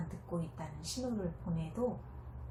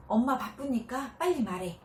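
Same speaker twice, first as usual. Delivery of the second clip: impatient brush-off; 6 semitones higher, 7 dB louder than usual. Acted. Busy mother brushes child off.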